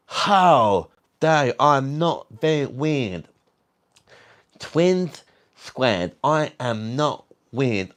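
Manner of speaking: high, drawn-out camp outrage; camp indignation. Pretend. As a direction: stretch it out like a fabulous, affronted diva. Plays camp offence at being called weird.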